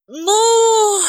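a long high musing "Ну"; drawn-out thoughtful well. Acted. Dalila muses over her plans with a long "Ну". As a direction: stretch "Ну" while thinking. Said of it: "задумчиво протянула Далила".

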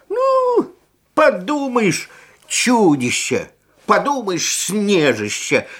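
put-on cross boy scoffing at the snow monster; annoyed boy. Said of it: "рассердился".

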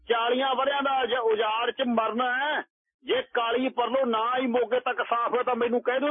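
put-on high-pitched drunken tirade at the village; a drunk baba railing at the sleeping village; drunken cursing. A narrator's reading. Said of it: "ਪਿੰਡ ਵਾਲਿਆਂ ਨੂੰ ਗਾਲਾਂ ਦੇਣ ਲੱਗ ਗਿਆ". (ਉੱਚੀ ਸੁਰ ਵਿੱਚ ਸ਼ਰਾਬੀ ਗਾਲਾਂ)

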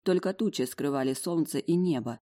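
usual speaking voice

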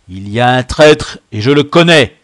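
loud, forceful accusation; thundering. A narrator's reading. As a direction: declare it at thundering volume. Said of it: "tonna de rechef le Braque". (tonner, accusateur)

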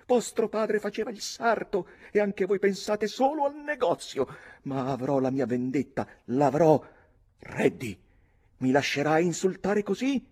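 put-on high, vengeful girl's tirade; theatrical high voice, indignant; outraged young lady. A narrator's reading. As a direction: rail at the captain, then appeal for help. Nora rages at the captain.

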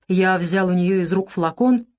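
plain reading voice

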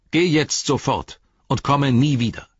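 high, loud order; stern command. Acted. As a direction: banish him firmly. Frodo orders Saruman away.

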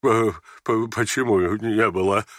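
stammered, bewildered question; muttering. Acted. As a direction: stumble on the first sound, lost for words. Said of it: "пробормотал генерал". (растерянное бормотание с заиканием)

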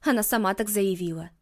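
ordinary delivery, nothing marked

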